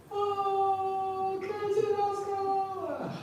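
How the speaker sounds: very high, drawn-out falsetto wail; screaming mother. Retold or imitated. Mother blaming her rescuer as she is thrown, mock wail. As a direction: wail it out like a falling, outraged victim. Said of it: "blaming him".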